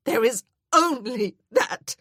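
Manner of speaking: high, loud, sobbing insistence; anguished wail; crying. A narrator's reading. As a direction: cry it out with finality. Grieving woman refuses comfort.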